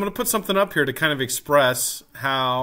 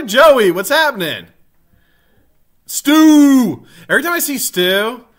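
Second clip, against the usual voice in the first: loud, high-pitched roll call of friends; excited shout-out; 7 semitones higher, 9 dB louder than usual. Real, not acted. Streamer calls out greetings to viewers.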